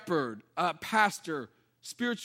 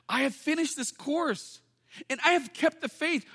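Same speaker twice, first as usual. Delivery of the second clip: rising high with a strong, proud finish; Paul's triumphant last words, rising high; triumphant declaration; 6 semitones higher than usual. Retold or imitated. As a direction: proclaim it like a victory. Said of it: "but he's saying".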